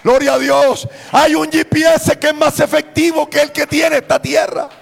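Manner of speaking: loud, high, exhorting sermon; fervent preacher in full cry; full-force preaching. Real, not acted. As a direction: proclaim it to the whole church at high volume.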